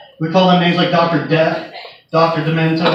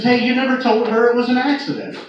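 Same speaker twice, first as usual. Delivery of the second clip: higher, earnest insistence; insistent patient; 6 semitones higher than usual. Retold or imitated. Patient pleading with the nurse as the X-ray tech leaves, higher and insistent. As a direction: make sure she knows it was an accident.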